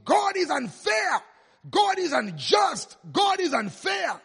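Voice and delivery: high, loud, slow cry of protest; shouting. Retold or imitated. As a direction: shout it out in outrage, dragging the words.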